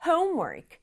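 homework, HOME high and long, work dropping; stress on first syllable. Shown as a demonstration. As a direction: punch the front of homework. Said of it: "앞부분 강조해서".

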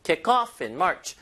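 "kick off" with the weight on "off"; stress on off. Shown as a demonstration. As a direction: punch "off" and stretch the phrase slowly. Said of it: "힘을 뒤에다 줘야 되죠".

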